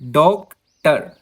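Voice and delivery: Doctor slowly split, weight on डॉक; slow stressed डॉक, then टर apart; stress on first syllable. Shown as a demonstration. Teacher repeating a trochee example, stress on डॉक in doctor. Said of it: "first syllable दोस्तों stressed है".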